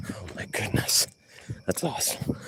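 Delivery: hushed breathy whisper of delight. Real, not acted. Whispered aside to himself while gaming, awed. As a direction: whisper it like a secret wow. Whispered reaction after shekels.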